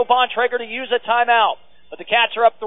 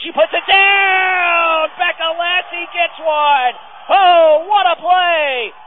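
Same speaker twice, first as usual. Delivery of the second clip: excited call; 5 semitones higher, slower than usual. Real, not acted. Radio announcer calling a spike to listeners, excited. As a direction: sell the kill to radio listeners. Big point in volleyball match.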